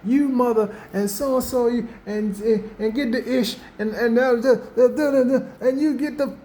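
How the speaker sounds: high-pitched sputtering fake tirade; a high, silly mock rant; mock cursing rant. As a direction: play a mock swearing fit with the words blanked out. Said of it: "See, it wasn't even heavy".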